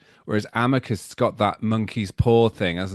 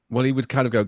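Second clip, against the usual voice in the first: normal talking voice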